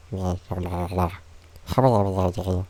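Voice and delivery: a put-on character voice with slurred blah blah; Scritch voice. Shown as a demonstration. Greeting everybody as Scritch in the character voice. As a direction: mumble in the character voice. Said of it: "this is my Scritch voice".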